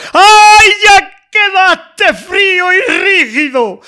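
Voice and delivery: high, loud sobbing wail, slowed; crying out. Acted. Lamenting his dead friend in the poem, loud sobbing wail. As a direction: wail the loss as if weeping over the body.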